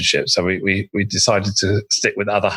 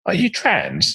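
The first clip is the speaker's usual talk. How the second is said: surprised; 11 semitones higher, much slower than usual. Retold or imitated. Colleague's surprised question.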